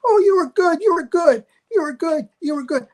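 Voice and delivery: high gushing praise that fades away; fawning high-pitched fan voices. Retold or imitated. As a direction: gush like a star-struck guest. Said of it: "people are coming up to me saying".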